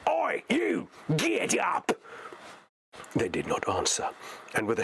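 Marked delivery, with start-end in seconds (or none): 0.1-1.9 much higher barking orc slave driver voice; a brutal orc spots deserters; orc yelling. Acted. Orc slave driver yells at two figures, high orc bark. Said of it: "and yelled".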